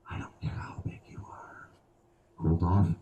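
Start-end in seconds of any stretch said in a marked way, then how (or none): 0.1-1.7 hushed unvoiced whisper. Comic whispering to a big guy in the crowd. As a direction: lean in and whisper it to them.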